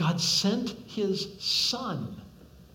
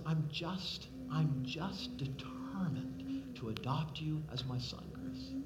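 tender voice of God; 2 semitones lower, 6 dB quieter, slower than usual. Pretend. God speaking tenderly to Chris.

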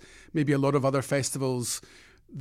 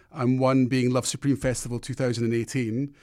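ordinary delivery

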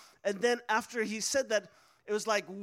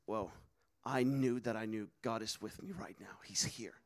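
very low, near-whispered realization; hushed awe; 11 semitones lower, 10 dB quieter than usual. Retold or imitated. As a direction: drop to a soft, low hush of wonder. Awed realization of God's presence.

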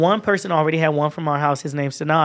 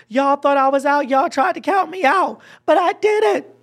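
climbing higher and louder to a shrieking peak; gloating triumph; 10 semitones higher, 3 dB louder than usual. Retold or imitated. Winner gloating at doubters, building to a high loud squeal. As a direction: rub it in, rising to a squeal.

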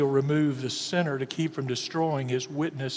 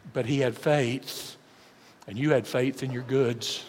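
ordinary talk

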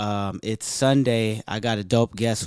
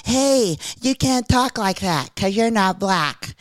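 high, put-on complainer voice; mock a naysayer in a high, slowed voice; mocking objector; 6 semitones higher, slower than usual. Imitating a listener objecting to how his guest talks, mocking voice. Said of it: "So don't be like".

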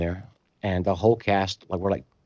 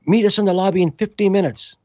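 higher, louder barked order; soldier's order; 10 semitones higher, 4 dB louder than usual. Retold or imitated. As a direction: command it like a soldier. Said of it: "she goes".

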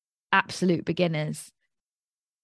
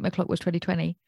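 ordinary talk

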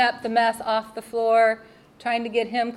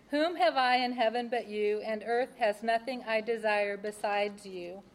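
soft, measured reading of a psalm, a little quieter; scripture reading; 6 dB quieter than usual. Acted. Reading her Bible verses to the audience, softly reverent. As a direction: read the verses like a quiet prayer. Reads Psalm seventy-three aloud.

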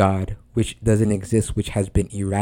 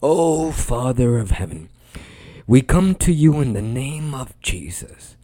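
mock-grand church prayer, stretched vowels, pitch swooping up; theatrical praying pastor; 3 semitones higher than usual. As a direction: intone it like a hammy pastor imitating pomp. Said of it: "he would just go".

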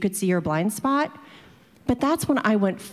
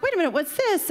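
higher, startled question; surprised; 9 semitones higher than usual. Retold or imitated. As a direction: react to a surprise charge. Surprise at extra fee.

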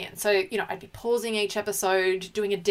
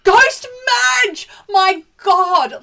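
very high, very loud outburst; overcome shout; 13 semitones higher, 13 dB louder, much slower than usual. Real, not acted. Co-host echoing the host about the finale, overcome shout. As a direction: shriek it back in disbelief.

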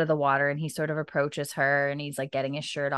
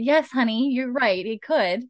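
mock supportive wife; 6 semitones higher, 4 dB louder than usual.

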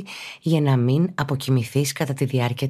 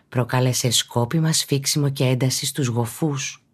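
ordinary talk

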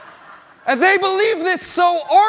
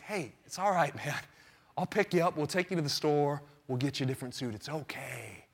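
much lower, much softer soothing friend; calm reassurance; 10 semitones lower, 12 dB quieter than usual. Retold or imitated. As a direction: talk a panicking buddy down gently. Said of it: "talks me down from defcon five".

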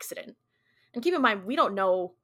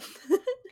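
short laugh; 12 semitones higher than usual. Real, not acted. Laughs after mock scare.

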